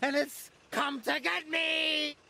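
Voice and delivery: high, slow, drawn-out shout; terrified wail. A narrator's reading. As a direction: wail as if hunted, stretching the words. Terrified Diesel reports a ghost.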